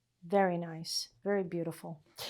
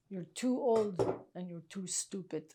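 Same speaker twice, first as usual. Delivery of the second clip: light hint of a Ukrainian accent, blunt; quoting the Ukrainian tailor's insult in a mild Ukrainian accent; 6 dB quieter than usual. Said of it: "He had a Russian accent".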